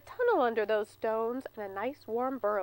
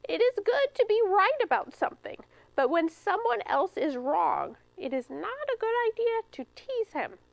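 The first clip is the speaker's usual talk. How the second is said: put-on much higher, careful old-squirrel voice; gentle grandmother squirrel; 9 semitones higher than usual. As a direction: warn a grandchild kindly.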